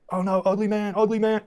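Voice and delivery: put-on high, taunting children's chant; a high playground taunt; mocking kids. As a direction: chant it like a crowd of mean kids. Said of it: "they're like".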